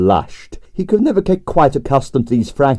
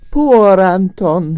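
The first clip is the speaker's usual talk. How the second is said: slow, high, sighing Russian dancer; 8 semitones higher, much slower than usual. A narrator's reading. Dancer sighing for the dead Anton, slow and high. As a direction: sigh the name mournfully, drawn out. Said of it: "he sighed".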